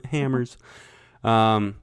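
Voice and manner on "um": usual speaking voice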